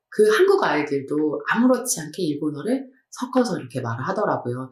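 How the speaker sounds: ordinary talk, nothing marked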